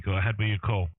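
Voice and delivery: normal talking voice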